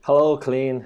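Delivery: ordinary talk, nothing marked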